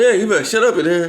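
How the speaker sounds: high, loud imitated warning; mock angry uncle. Uncle telling drunk nephew to hush, loud mock warning. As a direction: bark it like an annoyed uncle.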